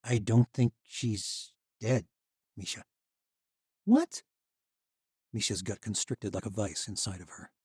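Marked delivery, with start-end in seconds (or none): 0.0-2.8 put-on slow, slightly drunk confession. A narrator's reading. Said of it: "smelled heavily of wine". 3.8-4.2 shocked. A narrator's reading. Shocked reaction.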